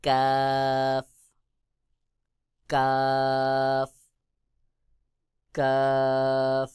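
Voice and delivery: কাফ held very long from just in front of the back of the tongue; drawn-out letter demo. Shown as a demonstration. Teacher showing students the letter কাফ. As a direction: stretch out কাফ slowly, Quran style. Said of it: "যেমন".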